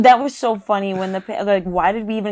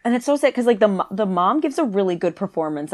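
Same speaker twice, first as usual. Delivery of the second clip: ordinary talk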